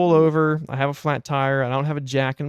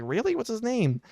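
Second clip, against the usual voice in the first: surprised interest; 9 semitones higher, 4 dB quieter than usual. Retold or imitated. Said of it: "And I was like".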